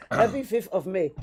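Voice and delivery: put-on light Nigerian accent on the greeting; lightly put-on Nigerian lilt. Greeting the hosts in a light Nigerian accent. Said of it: "In my Nigerian accent".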